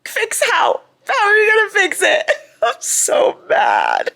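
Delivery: high, loud laugh-cry; laughing outrage. Real, not acted. Fan outraged at the characters' plan, laughing shout.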